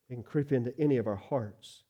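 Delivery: ordinary talk, nothing marked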